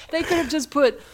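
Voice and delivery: ordinary talk, nothing marked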